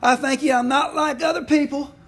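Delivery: high, loud boastful prayer; a self-satisfied Pharisee showing off to God; smug Pharisee. Retold or imitated. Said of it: "praying like this about himself".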